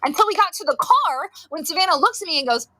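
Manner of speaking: usual speaking voice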